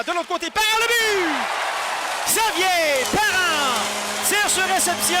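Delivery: stretched goal call. Real, not acted. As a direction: draw out the goal. Goal call in hockey play-by-play.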